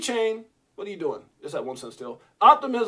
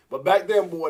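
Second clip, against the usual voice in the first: ordinary talk, nothing marked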